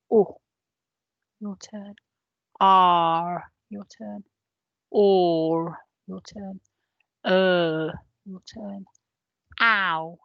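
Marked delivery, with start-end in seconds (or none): none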